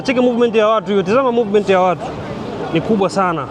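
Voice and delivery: ordinary talk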